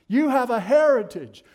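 loud, proclaiming it; loud preached declaration. Acted. Preacher proclaiming heritage to the congregation, loud. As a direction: boom it out to the pews.

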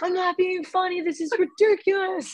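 high-pitched mock indignation; frustrated husband. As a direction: imitate an exasperated husband in a high voice. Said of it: "he would get really frustrated with me".

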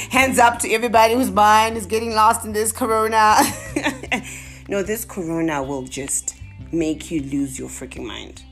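ordinary talk

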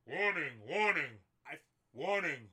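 loud, robotic alarm chant; smoke alarm voice. Retold or imitated. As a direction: blare it like a shrieking alarm. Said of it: "the ear-piercing sound of the carbon monoxide detectors".

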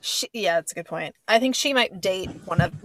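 ordinary delivery

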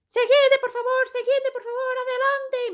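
high shrill mock agent calling the line; high mock yelling gate agent; mock shouting agent. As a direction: imitate a stern agent barking. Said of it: "com cara de maus".

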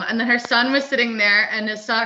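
ordinary delivery

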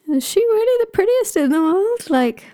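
high, squeaky mock-shocked ogre. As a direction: gasp it out like a stunned suitor. Ogre Thrym voiced, shocked.

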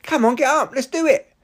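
rally yourself like an eager coach; peppy inner voice. Pretend. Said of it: "my body was like".

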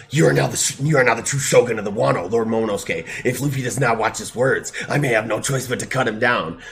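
loud, stiff samurai proclamation. Retold or imitated. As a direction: boom it out like a grave samurai. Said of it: "he's like".

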